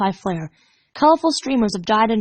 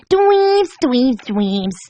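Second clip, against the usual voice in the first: mocking dweebs; 3 semitones higher, 3 dB louder, much slower than usual. Pinkie mimicking Gilda jeering dweebs. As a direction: sneer it like a bully. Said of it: "Pinkie mimicked".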